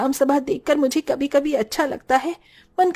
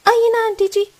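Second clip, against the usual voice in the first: eager invitation; 8 semitones higher, 5 dB louder than usual. Acted. Inviting aunt into auto.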